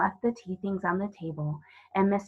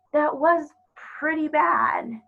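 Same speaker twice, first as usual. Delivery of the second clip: put-on slow, higher, careful girl's voice; slowly; 9 semitones higher, 5 dB louder, slower than usual. Lucy speaks slowly to the fawn, trying not to be harsh. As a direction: pick the words gently. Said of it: "said Lucy rather slowly".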